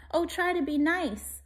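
high pleading whimper. Acted. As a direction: beg like a frightened lion. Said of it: "he whimpered".

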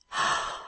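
sharp intake of breath; acted gasp of shock; startled gasp. Woman recoils in shock.

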